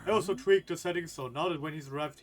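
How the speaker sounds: eager teammate voice. Acted. Teammate voiced in game reading.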